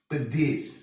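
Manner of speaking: usual speaking voice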